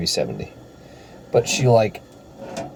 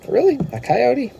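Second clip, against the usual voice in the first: high, rising disbelief; mock incredulity. Runner privately doubting the coyote story, high-pitched incredulity. Retelling his doubtful thought.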